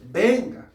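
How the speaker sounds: "Venga" set apart and punched; "Venga" stressed against what went before. Real, not acted. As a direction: lean on the word "Venga" as a correction. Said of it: "No dice, "Ya instalé tu reino". Dice".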